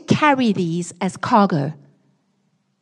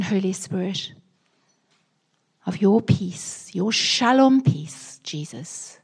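hushed, slow earnest prayer to the Lord. Acted. Pastor praying for the congregation, hushed and earnest. Prays aloud in the sermon.

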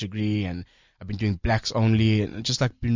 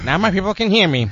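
a hint of an old-time radio announcer, higher; 1950s radio voice; 8 semitones higher, 6 dB louder than usual. Host showing the guest a mild fifties announcer voice. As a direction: lightly mimic a fifties broadcaster. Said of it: "they talked a little like this".

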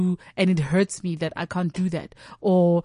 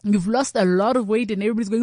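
normal talking voice

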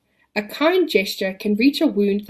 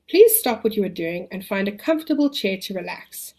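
calm the listener, long pauses. Acted. Leads a relaxation exercise.

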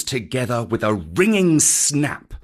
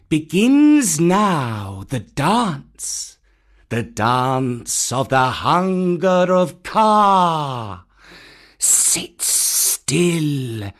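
slow, drawn-out hypnotic snake; Kaa the python; 4 semitones higher, 3 dB louder, much slower than usual. Acted. Kaa opening his dance before the monkeys, slow commanding python.

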